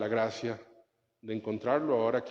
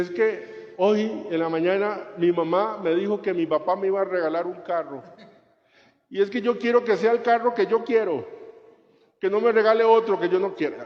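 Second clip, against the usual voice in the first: higher, louder, whiny imitation of a pampered girl; spoiled crying girl; 7 semitones higher, 5 dB louder than usual. Priest mocking a student crying over a car, hammed-up whine. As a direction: ham up her petty sob story, building. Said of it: "llegó una muchacha llorando".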